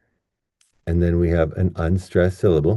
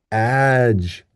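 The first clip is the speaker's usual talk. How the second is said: "ag-" held long and pitched up; stressed first syllable; 5 semitones higher than usual. Shown as a demonstration. Teacher isolating the stressed syllable, "ag-" drawn out high. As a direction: lean hard and long on "ag-". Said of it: "this syllable right here is going to be".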